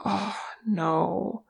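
soft, breathed dismay; hushed and stricken. A narrator's reading. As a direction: let it out on a breath. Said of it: "Thror breathed".

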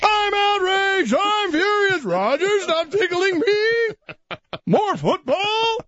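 high, hammed-up outrage that keeps cracking into giggles; mock outraged fan. Host plays a fan telling Roger to stop tickling him, high giggly squeals. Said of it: "I was shaking my torch and a pitchfork".

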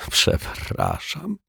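whispered. Acted. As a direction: breathe out a shamed sorry. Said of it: "wyszeptał pan Mietek". (szeptem, ze wstydem)

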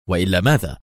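mildly challenging question; sharp. A narrator's reading. Woman challenges the ambassador's threat, sharply. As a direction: dare him to finish, understated edge. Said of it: "ولكنها قالت في حدة".

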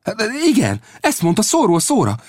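blurting out in amazement; excited cry. Acted. Young Will cries out to Old Death in amazement. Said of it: "kiáltott fel a fiatalember".

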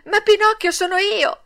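high, loud burst of excitement; eager high-pitched cry; excited exclamation. A narrator's reading. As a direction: blurt it out as a thrilled child. Puppet jumps up to claim name.

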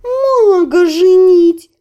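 high, loud, slow laughing cat. A narrator's reading. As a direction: howl it through laughter.

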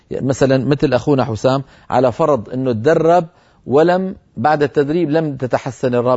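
ordinary delivery

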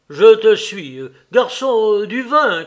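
loud, commanding officer's shout; thundering voice. Acted. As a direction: call out to the waiter across a room. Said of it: "cria-t-il de sa voix tonnante".

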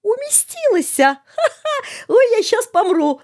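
very high, squealing hilarity; helpless laughter. A narrator's reading. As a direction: crack up until you can barely speak. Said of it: "зашёлся Фёдор". (заходясь от смеха, высоким голосом)

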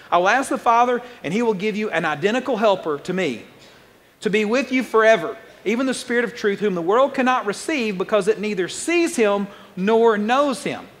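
a preacher reading scripture aloud with force. Acted. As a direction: proclaim the verse to the congregation. Reading Jesus' words from Scripture.

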